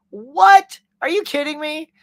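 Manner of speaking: high, loud burst of disbelief; can't believe free pizza came in the candy bag; genuinely surprised. Real, not acted. Streamer amazed at free pizza slices, high loud disbelief.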